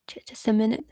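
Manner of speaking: whispered aside while searching. Real, not acted. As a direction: drop to a whisper to yourself. Looking for a lost comment.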